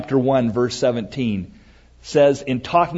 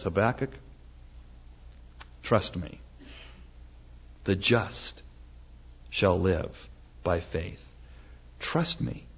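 soft, hushed, measured divine voice; the Lord; 6 semitones lower, 13 dB quieter than usual. Acted. God answering the prophet in his tower, quiet reassurance. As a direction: reassure gently, almost under the breath. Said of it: "the Lord comes to him and says".

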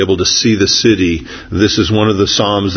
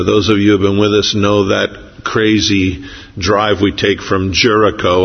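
usual speaking voice

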